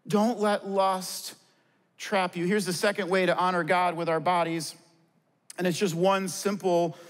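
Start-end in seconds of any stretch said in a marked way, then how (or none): 0.1-2.5 slow warning. Acted. Pastor's solemn warning.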